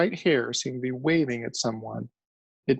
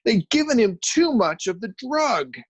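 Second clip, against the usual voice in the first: much higher, loud shout; 9 semitones higher, 4 dB louder than usual. A narrator's reading. Journalist shouting at the crew from his elbows. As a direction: shout it at them. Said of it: "shouted at them".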